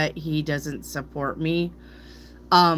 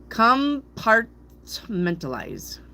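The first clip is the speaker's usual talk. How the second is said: slow, deliberate syllable by syllable, higher start; broken into syllables; 6 semitones higher, much slower than usual. Shown as a demonstration. Reader sounding out a word she can't say, broken into slow syllables. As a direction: pause between the parts of Compartmentalize. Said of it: "see, I can't say it".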